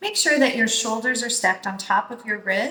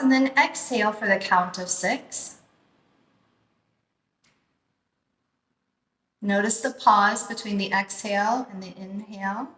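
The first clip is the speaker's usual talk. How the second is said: guided meditation. Acted. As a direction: ease them into calm breathing, unhurried. Leads a guided breathing meditation.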